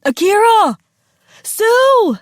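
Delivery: high, loud calling of names; anxious cry. A narrator's reading. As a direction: call out for lost children. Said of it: "they heard their dads cry".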